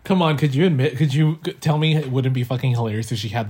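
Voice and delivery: ordinary talk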